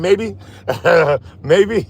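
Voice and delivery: high giggly maybe, breaking into laughter; laughing. Real, not acted. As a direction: laugh it off sheepishly. Laughs at own clumsiness.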